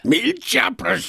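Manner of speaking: high-pitched grumbling oath; Blue Don Neuf. Acted. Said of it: "grogna l'électeur de Blue Don Neuf". (juron grogné, voix haut perchée)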